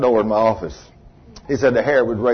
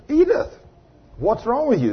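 high concerned call across the room; 10 semitones higher than usual. Retold or imitated. Preacher calling to Edith across the cafeteria, high and concerned. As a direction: call her name up high, worried.

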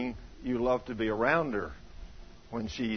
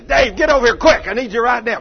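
loud, high, urgent holler; screaming for help; 10 semitones higher, 14 dB louder than usual. Acted. Pastor by the tractor summoning Dave, acted yell. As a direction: bellow for a helper across the yard. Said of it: "screaming at you".

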